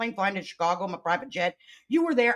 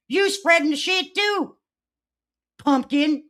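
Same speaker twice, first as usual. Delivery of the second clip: loud scolding; 7 semitones higher, 7 dB louder than usual. Real, not acted. Scolding a Reddit commenter.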